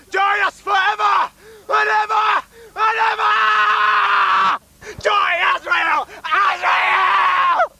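high ranting shout; haunted house actor. Acted. Cultist shouting chant.

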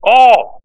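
All punched high and loud; stress on all. Real, not acted. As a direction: hit the word All hard. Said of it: "how many will stumble?".

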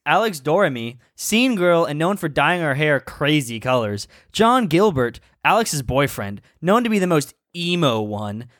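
reading a fanfic aloud; story narration. Acted.

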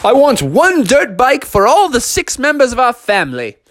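high, booming, grandly over-formal dad; pompous Father Bear. Acted. Father Bear ordering dirt bikes for the family, pompous proclamation. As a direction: declare it with hammed-up pomp. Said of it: "And Father Bear was like".

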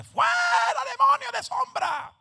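high, loud, slowed shout of a rebuking pastor; pastor's shouted rebuke. Retold or imitated. Pastor charging in to cast out the shadow, loud shout. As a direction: yell at the demon with all you've got. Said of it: "el pastor se mete".

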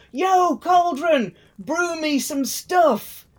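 high, slow, drawn-out call to the pot; mock command to cauldron. Hymir calling his magic cauldron to brew, high slow mock command. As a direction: summon the cauldron like a showman.

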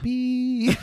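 high, sung-out bleep tone; censor bleep. Shown as a demonstration. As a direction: imitate a radio censor beep. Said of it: "but I'm gonna bleep it out".

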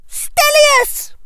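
very high spell cry; cried spell. A narrator's reading. Hermione firing a hex at Draco, cried out high.

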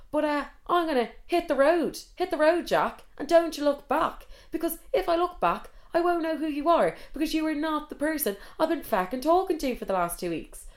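high-pitched, building mock tirade; indignant send-off. Imagined dumping of the catfish date, indignant. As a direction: work yourself up into a righteous rant.

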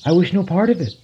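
high, loud cry of despair; a desperate outcry from the tree. Acted. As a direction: plead it out in anguish. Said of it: "in despair".

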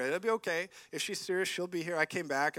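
ordinary delivery

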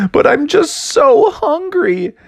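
high, drawn-out cartoon whine; pleading whine. Shark to frog about hunger, pitiful whine.

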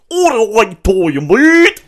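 loud, high, hammed-up Australian; mock Australian accent. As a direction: belt out a terrible Australian accent. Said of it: "What's your Australian accent?".

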